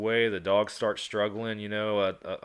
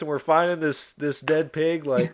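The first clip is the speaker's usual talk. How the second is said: laughing disbelief; 8 semitones higher, 5 dB louder than usual. Real, not acted. Teller still laughing about the dead pig, high and loud. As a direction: keep laughing through it.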